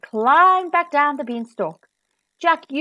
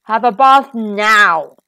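loud giant laying down the law; big, emphatic giant voice; commanding giant; 8 dB louder than usual. A narrator's reading. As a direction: boom the order, slowing hard at the end. Giant orders Jack to bathe.

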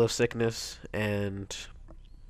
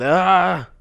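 sudden loud, higher cry; quick outburst of alarm; startled yelp; 8 semitones higher, 9 dB louder than usual. Real, not acted. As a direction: yelp as something goes wrong mid-game. Startled cry while playing game.

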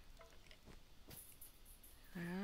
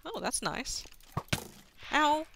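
ordinary talk

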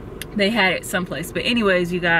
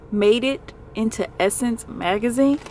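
ordinary delivery

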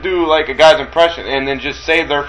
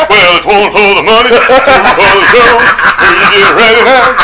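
higher, louder [name] croon, laughing; [name] impression through laughter; 6 semitones higher, 9 dB louder than usual. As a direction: belt out [name] lines while cracking up. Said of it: "look just like [name]".

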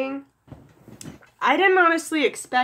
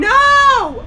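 sudden high, loud shout of no; anguished no; 12 semitones higher, 11 dB louder than usual. Real, not acted. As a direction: scream it in despair. Game loss moment scream.

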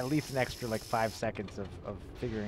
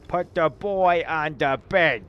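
loud; 4 semitones higher, 8 dB louder, much slower than usual. Real, not acted. Ordering the boy around.

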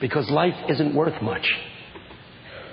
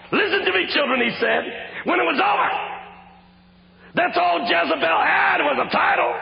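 preaching climax; 3 dB louder than usual. Real, not acted. Preacher's refrain on titles, climactic shouted preaching. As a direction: drive the refrain home in triumph.

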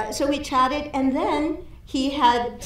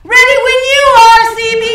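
much higher, much louder, fast cheerful shout from far off; yelled back; 13 semitones higher, 15 dB louder, much faster than usual. Retold or imitated. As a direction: yell it down cheerfully from the catwalk. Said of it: "the cameraman yelled back".

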